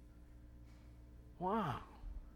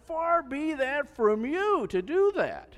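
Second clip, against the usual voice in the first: much higher, louder and slow, pleading hard; emphatic plea; 14 semitones higher, 10 dB louder, slower than usual. Retold or imitated. Preacher voices Abraham begging the Lord, loud slow plea. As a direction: beg the Lord earnestly, leaning on every word.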